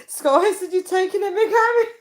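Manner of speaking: high, laughing read; real laughter reading chat. Real, not acted. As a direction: giggle through the name.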